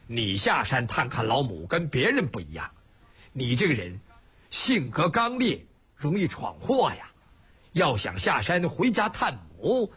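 low, deliberate voice of authority; grave elder-brother admonition, pitched low; stern, measured leader. Acted. Song Jiang lays down conditions.

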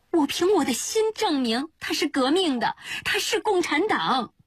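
very high-pitched, impassioned vow; desperate insistence. A narrator's reading. Answering an old friend's demand for proof, desperately insistent. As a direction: swear it from the heart, voice pitched right up. Dumbfounded 季草 swears by own heart.

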